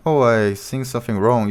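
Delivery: usual speaking voice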